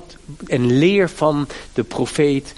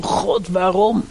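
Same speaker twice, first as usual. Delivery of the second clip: hoger, langzaam uitgeroepen vraag; crying out to God; 7 semitones higher, slower than usual. Retold or imitated. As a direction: een smekende uitroep naar God, hoog en traag. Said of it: "We mogen het best uitroepen naar God toe". (hoog en langzaam uitgeroepen)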